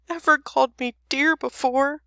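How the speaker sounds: high, lightly acted tearful dragon; touched and weepy, acted; sobbing dragon. A narrator's reading. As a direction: wail it as a mock sob. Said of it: "sobbed the dragon".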